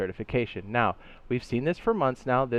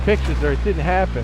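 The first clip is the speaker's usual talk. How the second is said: loud; 6 semitones higher, 8 dB louder than usual. Real, not acted. Cheering a shot.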